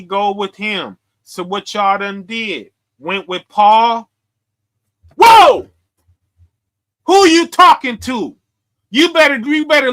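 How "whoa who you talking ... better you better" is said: sudden loud, high outburst; loud shocked challenge to the room; startled shout. Real, not acted. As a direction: call out the crowd, loud and high. Preacher calls out the crowd.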